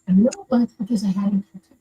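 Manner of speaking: ordinary delivery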